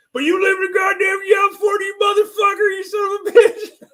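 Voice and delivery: high, loud furious screaming at the whole chat; furious yelling rant. Retold or imitated. Imitating the troll screaming at Mike about California. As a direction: blow up in a raging shout, cursing.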